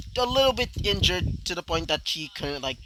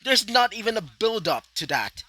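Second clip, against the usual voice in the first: raised, exasperated complaint; frustrated rant; 5 semitones higher, 5 dB louder than usual. Real, not acted. Fan ranting at the writers, louder and exasperated. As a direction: vent about the lazy plot twist. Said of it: "I'm a bit frustrated there".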